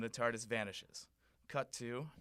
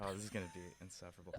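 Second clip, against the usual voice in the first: ordinary talk, nothing marked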